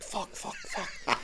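hushed little burst of mock panic; retold panicked swearing. Man finds hat stuck on shaved head, hushed panic. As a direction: swear under your breath, mostly voiceless.

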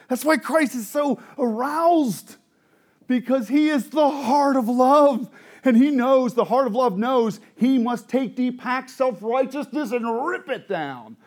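high, shouted, drawn-out sermon climax; full-force preaching. Real, not acted. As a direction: cry it out to the congregation.

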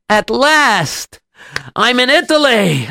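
high, drawn-out delight; triumphant arrival. Acted. Rabbi reaches Italy after months, high-pitched triumph.

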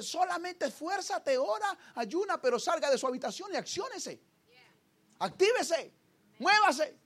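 God's urging voice. Acted. Pastor voicing God urging the believer. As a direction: urge them like God commanding.

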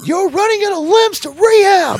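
very high hammed-up villain taunt; hammed-up Shayna taunt. Podcaster mimics Shayna taunting injured Tegan, hammed-up high voice. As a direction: push the taunt up into a high, exaggerated voice. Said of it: "She says".

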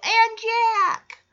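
put-on high, slow, drawn-out toddler voice; little child imitation. Michelle joining the demands on Jesse, imitated toddler. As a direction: chime in last, stretching "Jack".

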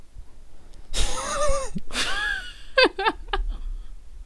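high giggling laugh; burst of laughter. Real, not acted. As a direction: breaks up laughing. (lautes Loslachen)

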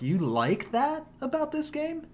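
mock incredulous. Mock reply to old fans.

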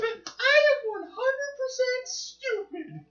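very high, slow, goofy voice; silly cartoon guest voice. Quoting the scorpion guest to a friend, high goofy voice. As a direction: ham up a dim cartoon villain.

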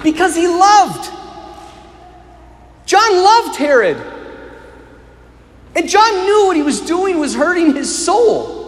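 high, ringing preacher's voice, pressing each line; impassioned sermon climax; preaching at full force. Acted. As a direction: lift it up and preach it out to the whole church.

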